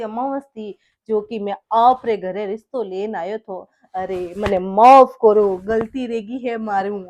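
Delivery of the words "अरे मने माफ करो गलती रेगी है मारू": slow, mocking plea for pardon; sarcastic apology. Husband mockingly apologises to his mother-in-law, slow sarcasm.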